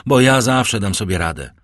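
usual speaking voice

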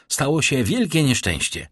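alarmed. Acted. Son bursts in with bad news.

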